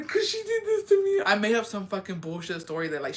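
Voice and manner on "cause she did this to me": very high, whiny fake-crying plea; crying excuse. On the phone with dad, wailing a made-up excuse. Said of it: "and so I started crying".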